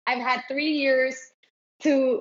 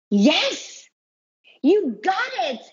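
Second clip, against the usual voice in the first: higher, emphatic retold cheer to a child; delighted agreement; 6 semitones higher, slower than usual. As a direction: act out cheering a child's insight. Mother acts out agreeing with daughter.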